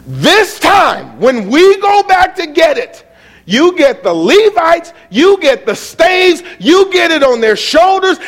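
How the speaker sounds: high, loud, slow emphatic preaching; preaching at full force. Acted. Preacher to congregation, rising to a shout. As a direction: drive home each step to the congregation. Preaching the careful ark procession.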